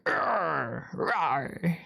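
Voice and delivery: a mild, playful mock roar; tiger impersonation. Doing a tiger for the listener, a light mock roar. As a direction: roar like a tiger, but only half-heartedly. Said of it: "that was my tiger impersonation".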